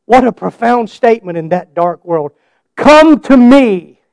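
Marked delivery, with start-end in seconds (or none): 2.8-4.0 slow, loud and higher; preached invitation. Acted. Preacher voicing Jesus' call to the weary, slow loud proclamation. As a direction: stretch out each word.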